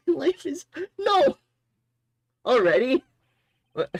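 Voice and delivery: real high, loud shriek of disbelief; shocked cry. Real, not acted. Streamer reacting to a shock, shrieking. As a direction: yelp in shock.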